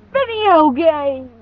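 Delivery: high, loud, drawn-out exclamation; mock impression. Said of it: "that's my best impression".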